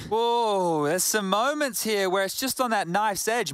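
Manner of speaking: excited reaction. Real, not acted. Reacting to tense round ending.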